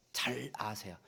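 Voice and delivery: breathless unvoiced whisper; whispered aside. Real, not acted. Preacher confiding to the congregation, a sudden whisper. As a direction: drop to a whisper as if sharing a secret. Hushed aside about gifted people.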